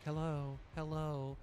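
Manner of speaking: soft, unheard hello; small quiet voice trying to get attention; timid lady calling. Retold or imitated. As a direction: call out meekly for service, softly. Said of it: "she was like".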